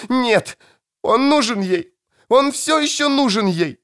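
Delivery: high shrill desperate scream; shrill screeching bird woman. Acted. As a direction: shriek in terror, begging. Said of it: "закричала она".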